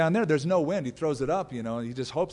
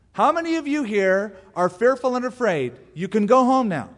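high, raised announcement to troops; calling out; 6 semitones higher, 5 dB louder than usual. Acted. Gideon gets up to dismiss his fearful men, calling out. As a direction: address a whole army.

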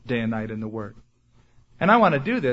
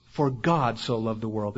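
God stressed, the rest dropping lower; 5 semitones lower, 3 dB quieter than usual. Shown as a demonstration. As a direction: land on God as the correction, then let it fall. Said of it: "emphasize different words in that verse".

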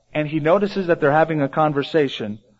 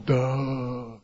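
a slow, dim-witted duh, much softer; lightly voiced dullard grunt; dopey duh; 4 semitones lower, 9 dB quieter than usual. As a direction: play the clueless disciple. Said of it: "they were just going".

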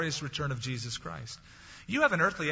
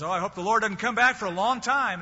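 mock worried believer; 6 semitones higher, 6 dB louder than usual. Mocking a false believer.

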